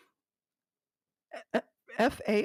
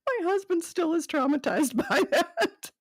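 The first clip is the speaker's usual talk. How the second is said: high, laughing through the words; 7 semitones higher than usual. Real, not acted. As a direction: break into real laughter mid-line. Laughing at husband's trauma.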